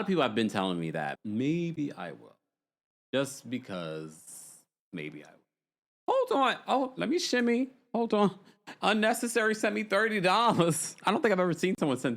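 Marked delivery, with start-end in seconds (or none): 1.2-6.1 very slow, stretched-out words, sinking low and soft; toying slowly with the idea; drawn-out musing. Real, not acted. Coyly considers viewers' recap request. 6.3-11.0 high-pitched delighted disbelief; real high-pitched thrill at a donation; excited surprise. Real, not acted. Thrilled by a big viewer donation.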